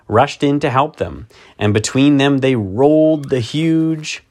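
ordinary delivery, nothing marked

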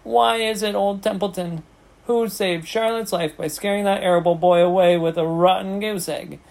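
high-pitched, self-important recital; taunting. A narrator's reading. Rat reminding pig of his favours, taunting. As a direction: crow over each past favour. Rat boasts of past services.

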